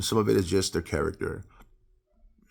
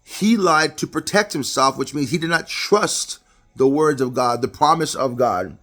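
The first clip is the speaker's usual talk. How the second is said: loud, higher-pitched sermon delivery; preaching; 6 semitones higher, 6 dB louder than usual. Real, not acted. Preacher teaching congregation about Isaac's fear, emphatic preaching. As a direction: preach it out, driving home key words.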